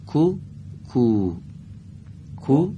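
کو said light and thin, not thick; thinner کو. Shown as a demonstration. Teacher showing that this letter is thinner. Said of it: "اینجا نازکتر".

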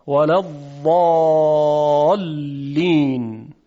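مغضوب and الضالین drawn out slowly, the ض sounded from the tongue's side; measured tajwid reading with a careful ض; slow Quran recitation. Shown as a demonstration. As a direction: recite slowly, leaning on each ض. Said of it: "شما هم تمرین بکنید".

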